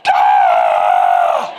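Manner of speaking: loud, very low strangled growl of frustration; frustrated roar. Retold or imitated. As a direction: let out a furious growl. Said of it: "I'd be like".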